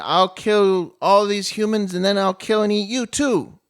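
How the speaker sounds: loud, high acted demon threat; angry threat. Angry demon threatens his sister and the humans, loud acted fury. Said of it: "he's angry".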